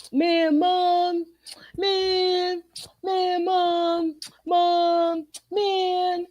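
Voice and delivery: put-on high whiny teenage groan; whining teen son. Mother mimicking her son whining at her yelling, high whine. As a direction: whine mom, man like a fed-up teen. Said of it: "That's all I hear".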